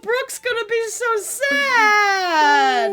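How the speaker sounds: high loud fake crying shout; mock wailing. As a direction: wail it like a heartbroken fan. Mock grief over lost record.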